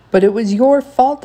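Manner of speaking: high, loud, tight accusation; through clenched teeth. A narrator's reading. Son blames mom in the car, through clenched teeth. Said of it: "I said through clenched teeth".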